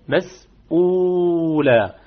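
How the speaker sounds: مسؤولة drawn out slowly, madd kept to two beats; short madd demo. Shown as a demonstration. Teacher recites the example word for the class. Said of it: "فقط القصر، حركتين فقط".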